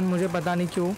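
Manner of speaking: ordinary talk, nothing marked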